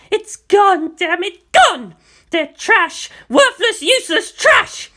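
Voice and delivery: very high, loud hysterical screaming; furious scream. A narrator's reading. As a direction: scream it at the boy in rage. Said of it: "she screamed at him suddenly".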